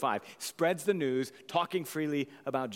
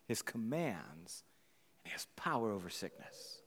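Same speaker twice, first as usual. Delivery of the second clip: dropped to a near whisper; hushed; 7 semitones lower, 8 dB quieter than usual. Real, not acted. Preacher drops to a hush as the leper is healed. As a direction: let the words fall quiet and reverent. Hushed climax of healing story.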